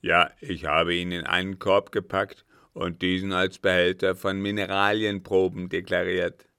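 loud, firm captain's voice; forceful captain. A narrator's reading. Captain reporting to the count, forceful. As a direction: report it firmly and loudly.

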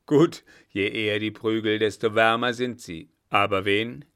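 loud, hearty commander; battle-eager old marshal. Acted. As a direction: welcome the fight with blustering relish. Blücher relishes the coming fight. (kampflustig polternder alter Feldmarschall)

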